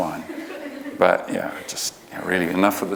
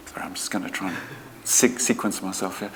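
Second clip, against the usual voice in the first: whispered aside; 2 semitones lower, 7 dB quieter than usual. Real, not acted. Speaker whispers an aside to the audience while regrouping.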